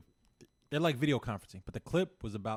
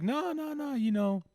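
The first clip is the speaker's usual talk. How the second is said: high defensive protest; 12 semitones higher, slower than usual. Retold or imitated. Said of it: "And he's like".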